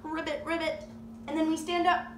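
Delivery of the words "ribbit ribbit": high, playful frog croaks; perky doubled frog call; frog ribbit. Pretend. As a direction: ribbit like a frog for small children. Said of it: "like a frog".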